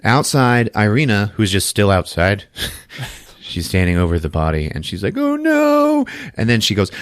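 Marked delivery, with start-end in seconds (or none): 5.2-6.0 high, wailing lament; hammed-up grief; mock dismay. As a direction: wail it way up high over a body. Acts woman over the body.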